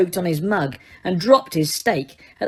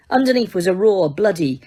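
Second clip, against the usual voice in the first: ordinary delivery, nothing marked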